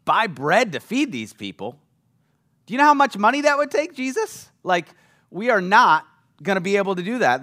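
high, loud disbelieving protest; exasperated disciple, pitch jumping up; incredulous Philip. Retold or imitated. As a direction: scoff at the idea as Philip. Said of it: "And Philip goes".

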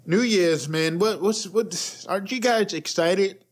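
ordinary talk, nothing marked

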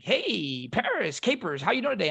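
high, sing-out phone greeting; chummy recruiter. Retold or imitated. Recruiter greets his recruit by phone, chummy and high-pitched. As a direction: imitate a recruiter's breezy hello.

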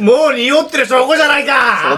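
high, drawn-out mock outrage; pompous comic indictment; mock accusation. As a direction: accuse slowly, hamming up the indignation. Mock accusation of nasal denial.

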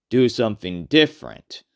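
ordinary delivery, nothing marked

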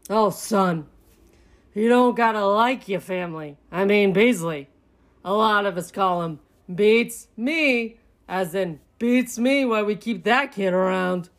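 firm dad character voice; a father laying down family truth; fatherly raccoon. A narrator's reading. Dad explains the family business to his son, fatherly.

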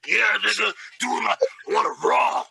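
mock woman talking like a bro; bassy guy voice. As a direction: lightly put some bass in it, like one of the guys. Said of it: "Take the bass out your voice".

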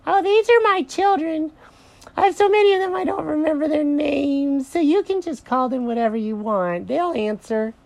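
very high cartoon bunny mother; high-pitched, carefree character voice; breezy Mary the rabbit. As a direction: chatter airily in a squeaky rabbit voice.

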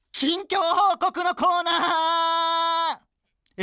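very high, loud, long-drawn corner call; shouted title call. Pretend. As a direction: belt out the title, stretching the end. (コーナー名を高く長く絶叫)

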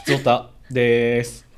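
loud, slow radio-style call; drawn-out announcement. Acted. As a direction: stretch out the segment call. Announces mail segment loudly. (ラジオ風の呼び込み)

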